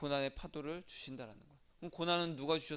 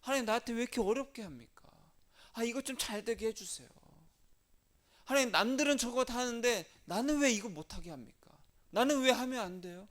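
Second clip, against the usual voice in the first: higher, whining plea to God; higher, whiny mock complaint to God; mock complaining prayer; 5 semitones higher than usual. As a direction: act out a grumbling believer, higher. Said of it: "하나님을 원망한다는 건 뭐예요".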